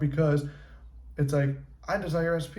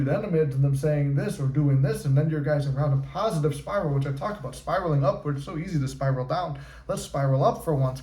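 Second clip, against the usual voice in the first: normal talking voice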